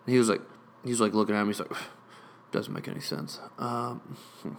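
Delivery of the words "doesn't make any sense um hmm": lower, muttered under the breath; mumbled. Retold or imitated. Imitating the clerk muttering at his screen, much softer. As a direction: mumble it dismissively to yourself.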